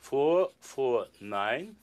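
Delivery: very slow, deliberate echo of each digit; slow repeat. Real, not acted. Magician echoing the volunteer's digits, slow and deliberate. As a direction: spell the numbers back one at a time.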